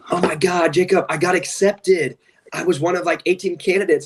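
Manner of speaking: high, eager, bubbly imitation of an applicant; gushing caller. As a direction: play the thrilled new student.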